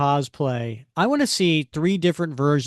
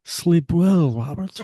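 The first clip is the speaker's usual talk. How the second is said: slug voice; 2 semitones higher, 3 dB louder, slower than usual. Pretend. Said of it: "my new ringtone".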